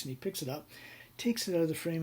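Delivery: ordinary talk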